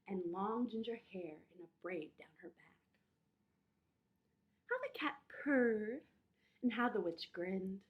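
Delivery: put-on lively read-aloud for children; story narration.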